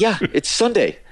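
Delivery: high, incredulous confirmation; exasperated. Retold or imitated. Groom reminding Chet of the wedding day, exasperated. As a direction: push the pitch up at the obvious answer.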